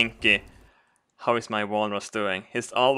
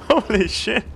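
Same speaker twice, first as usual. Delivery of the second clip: high wheezing laugh into holy shit; laughing hard; 11 semitones higher, 5 dB louder than usual. Real, not acted. Player cracking up at trick on Oslo, laughing. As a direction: laugh till it squeaks.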